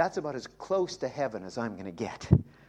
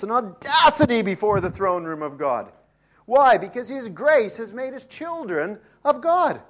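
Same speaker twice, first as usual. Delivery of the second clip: louder and higher, building to a ringing cry; building preaching shout; 8 semitones higher, 10 dB louder than usual. Real, not acted. Preacher rousing the congregation, shouting with fervour. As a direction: preach it out full force.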